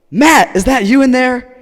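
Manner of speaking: high, very loud holler; shouted question. Retold or imitated. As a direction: holler through a door at your buddy. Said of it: "and they go".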